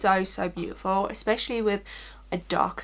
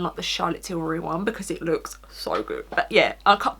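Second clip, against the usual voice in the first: ordinary delivery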